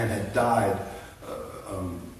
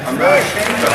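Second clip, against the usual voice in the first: raised, high-pitched proclamation; emphatic declaration; 9 semitones higher, 10 dB louder than usual. Real, not acted. Speaker declares his faith to a cheering audience, emphatic and loud. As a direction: proclaim it loudly to the whole crowd.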